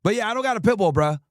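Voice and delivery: normal talking voice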